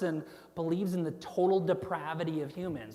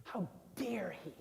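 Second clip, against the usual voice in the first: whispered, scandalized disgust; hushed outrage, mostly breath; 8 dB quieter than usual. Acted. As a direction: whisper it as an appalled Pharisee. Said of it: "directing the disgust at Jesus".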